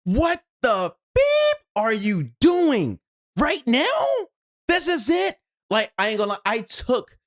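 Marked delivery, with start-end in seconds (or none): 0.0-5.4 angry at shoes. Retold or imitated. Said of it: "I was so pissed at my shoes".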